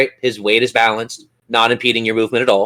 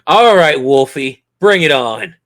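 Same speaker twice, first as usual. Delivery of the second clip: louder, higher gleeful taunt; playful challenge; 4 semitones higher, 6 dB louder, slower than usual. Pretend. Game master dares the player to beat his roll, gleeful taunt. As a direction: dare her to beat the roll.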